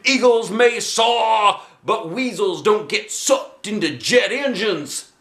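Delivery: high, slow, drawn-out manic voice; maniac coach. Acted. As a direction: preach nonsense like a madman. Maniac teacher's nonsense.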